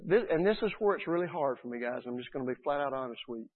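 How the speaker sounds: normal talking voice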